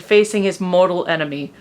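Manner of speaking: ordinary delivery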